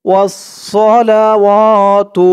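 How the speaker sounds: ওয়াসসালাওয়াতু with the last ওয়া stretched; long wa. Shown as a demonstration. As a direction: hold the ওয়া before তু. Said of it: "ওয়া টান হবে".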